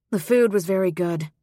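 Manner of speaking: stiff. A narrator's reading. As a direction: say the compliment stiffly, as a formality. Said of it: "she said stiffly".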